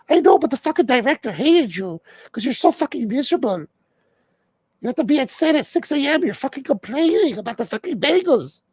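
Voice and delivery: hammed-up girlfriend nagging in falsetto; wife's voice. Comic voices [name] nagging [name], high mock girlfriend voice.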